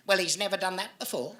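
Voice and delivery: lightly voiced prim old lady, brushing it off; a hint of a haughty old woman waving away blame; snooty old lady. Old lady dismisses the bite.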